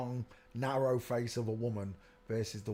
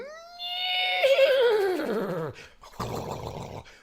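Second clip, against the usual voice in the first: long high shriek of laughter; 7 dB louder than usual. Real, not acted. Laughing at a photo.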